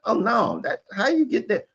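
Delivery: high-pitched, incredulous imitation; mock baffled objection. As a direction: act out someone balking at being misunderstood. Acts out a misunderstood listener's reaction.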